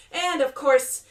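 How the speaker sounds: usual speaking voice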